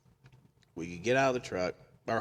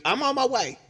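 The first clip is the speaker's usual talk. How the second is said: high, loud imitation of the caller; excited phone announcement; 14 semitones higher, 9 dB louder than usual. Caller tells the funeral worker she's coming, loud and excited. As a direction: call it out loudly, high-pitched.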